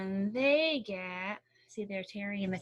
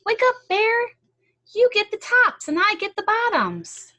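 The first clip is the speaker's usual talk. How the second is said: much higher, loud shout to wake him; calling out; 12 semitones higher, 12 dB louder than usual. Acted. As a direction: holler across the field at the sleeper. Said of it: "Hare called out".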